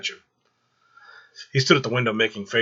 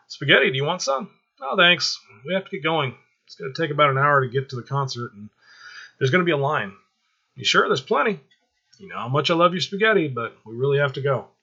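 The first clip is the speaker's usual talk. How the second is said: higher, louder female character voices; 5 semitones higher, 5 dB louder than usual. Acted. Angela and Lisa chatting over spaghetti, higher female voices. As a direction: lift the voice for the women talking.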